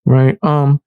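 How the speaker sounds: ordinary talk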